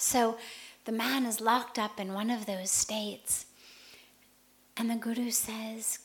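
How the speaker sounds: normal talking voice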